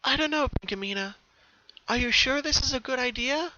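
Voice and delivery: high, hesitant little sister; doubtful younger sister. Acted. Bellamina to her sister at the farm's edge, doubtful. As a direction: question it timidly. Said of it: "Bellamina asked".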